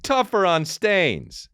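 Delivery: high, slow, drawn-out slogan; hammed-up commercial sign-off; mock ad tagline. Announcer closes the parody ad, mock slogan voice.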